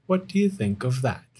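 plain reading voice

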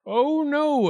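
high, loud protest; objecting. A narrator's reading. Wildcat refuses Ichiro's modesty, high objection. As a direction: protest emphatically. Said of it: "objected Wildcat".

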